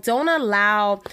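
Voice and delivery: usual speaking voice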